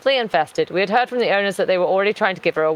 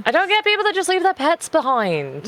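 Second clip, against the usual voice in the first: higher-pitched, incredulous outburst; exasperated disbelief; 9 semitones higher than usual. Real, not acted. Cat owner on people abandoning pets, exasperated.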